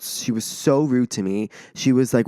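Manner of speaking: normal talking voice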